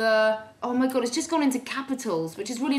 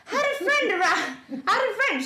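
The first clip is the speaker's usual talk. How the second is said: high posh storytelling voice; put-on high posh voice; posh boy's voice; 5 semitones higher than usual. Shown as a demonstration. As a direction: tell it in a silly, horsey posh voice. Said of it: "my stupid posh boy's face on".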